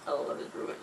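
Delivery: teasing whispered aside. Real, not acted. Teasing about the pair holding hands, whispered. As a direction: whisper it like a secret.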